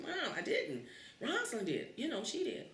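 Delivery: put-on high, pleading child's denial; child protesting. Kid pleading innocence to mom, high denial. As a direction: insist like a wronged kid.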